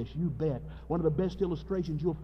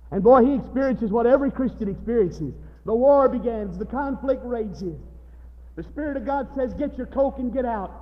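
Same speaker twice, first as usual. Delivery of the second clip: high, loud pulpit delivery; full-force preaching; 9 semitones higher, 8 dB louder than usual. Real, not acted. Preacher describing the war of flesh and Spirit, full-force preaching. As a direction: preach the battle with driving energy.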